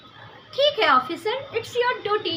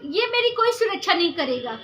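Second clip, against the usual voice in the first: ordinary talk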